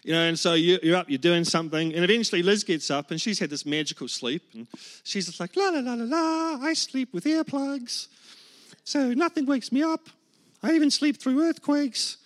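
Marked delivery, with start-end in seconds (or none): 5.6-12.2 high-pitched sing-song chirp; blithe wife. Acted. Well-slept wife chirps to sleepless husband, mock carefree sing-song. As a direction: lilt it airily, far above your own voice.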